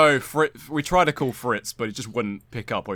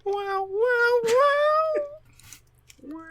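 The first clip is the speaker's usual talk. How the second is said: mock crying; much slower than usual. Mock hurt at a joke.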